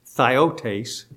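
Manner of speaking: Theotes as THIGH-oh-tays; pronunciation of Theotes. Shown as a demonstration. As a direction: say "Theotes" with a long "eye" first. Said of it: "is how it would be pronounced".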